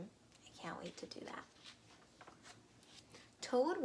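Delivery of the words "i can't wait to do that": breathy soft whisper; almost to yourself. Real, not acted. Teacher confiding to the children, whispered.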